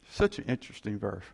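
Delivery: talking while chewing gum. Real, not acted. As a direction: preach around a wad of gum. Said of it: "I'm chewing gum while I'm preaching".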